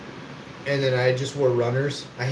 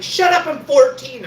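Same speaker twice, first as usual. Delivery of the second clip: a much higher, louder, defensive yell; indignant shout; 11 semitones higher, 7 dB louder than usual. Retold or imitated. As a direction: snap it back as an indignant lie. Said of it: "And I'm like".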